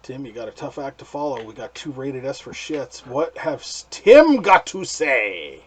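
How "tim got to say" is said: loud, stretched-out introduction; hammed-up host handover. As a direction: throw to the next reviewer, big and slow. Introduces next reviewer like a host.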